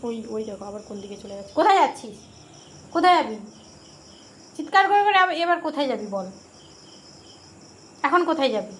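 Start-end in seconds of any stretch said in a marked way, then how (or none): 1.6-8.7 louder and higher mock scold at the pet. Real, not acted. Owner calls after her wandering pet, loud playful scold.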